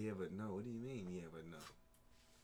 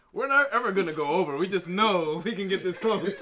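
loud; 6 semitones higher, 12 dB louder than usual. Retold or imitated.

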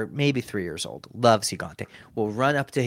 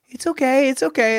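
high-pitched mock frantic soothing; urgent reassurance; 14 semitones higher, 5 dB louder than usual. Dad to his crying three-year-old, hurried high-pitched reassurance. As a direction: imitate a flustered parent, high and louder.

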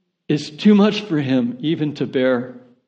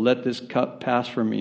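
quiet, low and subdued prayer line; soft prayerful reading; 3 semitones lower, 7 dB quieter than usual. Acted. Preacher reading Jesus' garden prayer softly, subdued. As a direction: murmur it gently, as a calm prayer. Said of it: "he's screaming at the top of his voice".

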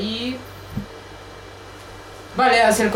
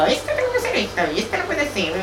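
a much higher mock protest; mock insistence; 9 semitones higher than usual. Retelling her own rule to the group, in a much higher mock voice. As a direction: squeak out your own rigid rule, mock-serious. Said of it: "Y si digo".

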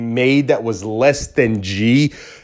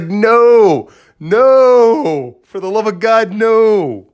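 high, loud pleading nos; anguished no; 9 semitones higher, 3 dB louder, much slower than usual. Retold or imitated. Begging God not to let it happen, high loud plea. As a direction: beg the heavens to stop it.

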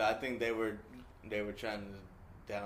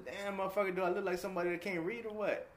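mock-indignant retort, pitched up; retold exasperation; 8 semitones higher than usual. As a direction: act out being fed up with a pest. Retold snap at nagging coworker.

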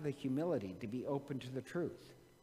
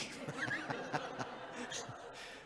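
soft airy laugh at the end of the story; breathy laugh; 5 dB quieter than usual. Real, not acted. Storyteller enjoying the punchline, soft breathy laugh. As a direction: let out a quiet breathy chuckle.

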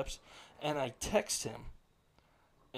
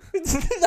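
high burst of laughter; 10 dB louder than usual. Real, not acted. Cracking up at the texts.

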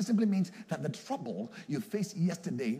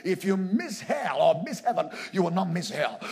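loud, high altar-call command; raised, urgent salvation appeal; preaching at full force; 5 semitones higher, 8 dB louder than usual. Real, not acted. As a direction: press the warning on the congregation, forcefully.

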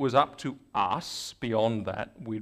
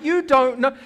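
much higher and louder; excited exclaim; 12 semitones higher, 8 dB louder, slower than usual. Retold or imitated. Speaker marvelling at the convert's testimony, high excited exclaim. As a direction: burst out with it. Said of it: "I'm like".

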